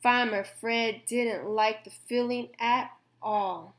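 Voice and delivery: ordinary delivery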